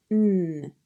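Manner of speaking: N as a held "nnn" hum; sustained nasal "nnn" for N; nasal N sound. Shown as a demonstration. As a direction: hum the sound of N through the nose, not the letter's name. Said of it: "The new sound N is a nasal sound".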